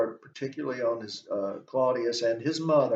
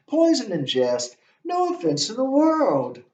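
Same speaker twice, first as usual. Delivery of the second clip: much higher and louder, mock innocent; mocking Hamlet; 11 semitones higher, 5 dB louder than usual. Lecturer playing Hamlet mocking Claudius, high mock reassurance. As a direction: wave it off with false cheer. Hamlet's line read again.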